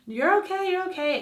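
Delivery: high-pitched, soothing imitation of a doctor; reassuring doctor. Doctor soothing her, retold.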